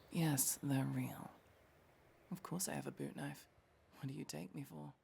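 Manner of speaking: soft unvoiced muttered aside; whispered aside. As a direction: whisper it half to yourself.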